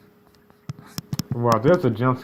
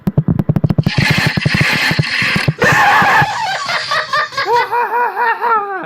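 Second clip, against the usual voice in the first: shrieking laugh; 6 dB louder than usual. Real, not acted. Reacting to jump scare.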